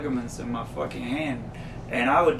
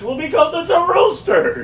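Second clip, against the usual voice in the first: much higher, loud mock shout as Seinfeld; imitating Seinfeld; 8 dB louder than usual. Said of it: "Seinfeld tells him".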